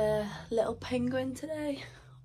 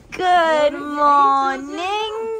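langgerekt luid goedemorgen; drawn-out greeting; 3 semitones higher, 8 dB louder, much slower than usual. Real, not acted. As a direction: rek het uit als een vrolijke groet. Greeting family on camera.

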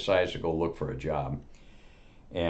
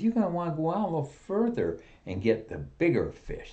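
eager salesman; 11 semitones higher than usual. Acted. Eager salesman upselling customer.

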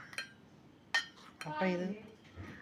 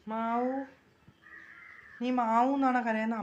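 put-on baby talk; 6 semitones higher than usual. Mother cooing to her baby.

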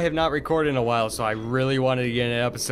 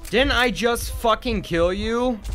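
higher, slow, outraged yell at the enemy; furious yell; 8 semitones higher, slower than usual. Real, not acted. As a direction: yell it at the screen in disbelief. Enemy survives, he's furious.

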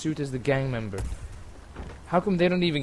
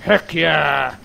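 triumphant; 5 dB louder, much slower than usual. Real, not acted. After killing target.

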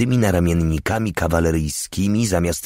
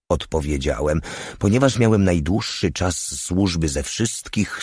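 ordinary delivery

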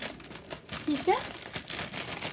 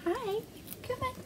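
soft coaxing; 11 semitones higher, 12 dB quieter than usual. Real, not acted.

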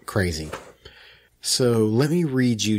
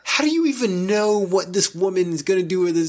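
indignant; 8 semitones higher than usual. Real, not acted. Outraged at comment.

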